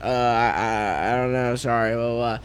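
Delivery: low, halting nervous apology. Retold or imitated.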